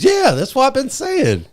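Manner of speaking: high, loud put-on voice for the buddy; mock his coworker in a squeaky exasperated voice; imitating Vel. Quoting coworker Vel to podcast friend, high mock voice. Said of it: "He goes".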